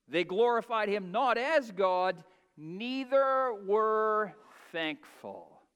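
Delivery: a rising preacher's climax, words drawn out; preaching at full force. Acted.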